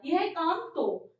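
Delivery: "तो" said slowly with weight; teacher stressing "तो" slowly. Shown as a demonstration. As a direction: linger on "तो" to show the particle. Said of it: "फिर जोड़ दिया".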